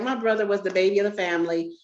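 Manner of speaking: normal talking voice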